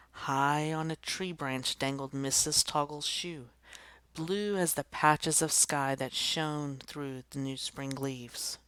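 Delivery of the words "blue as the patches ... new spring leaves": ordinary delivery, nothing marked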